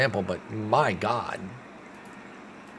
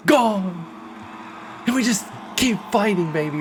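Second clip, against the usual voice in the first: higher and louder, shouting with joy as the ball leaves; excited home run call; 10 semitones higher, 4 dB louder than usual. Real, not acted. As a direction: yell it like your team just homered. Home run ties the game.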